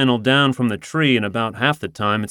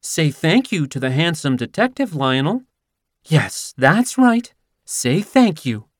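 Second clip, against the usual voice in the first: cooing; 5 semitones higher than usual. Acted. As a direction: coax the cat while eyeing him. Cooing to cat, eyeing detective.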